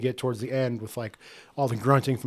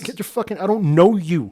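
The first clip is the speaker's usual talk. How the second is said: angry outburst; 5 semitones higher, 7 dB louder than usual. Acted. Acting out the kid's annoyed outburst.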